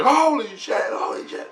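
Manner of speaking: high-pitched mock freak-out, falling away to a hush; imitated freak-out. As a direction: squeal it, then repeat it under the breath. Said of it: "he's freaking out now".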